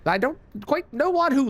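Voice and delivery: normal talking voice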